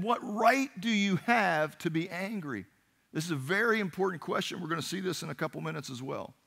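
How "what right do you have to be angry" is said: slow, high-pitched confrontation; stern challenge. Acted. God questioning angry Jonah's right, stern challenge. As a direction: challenge him, leaning on each word.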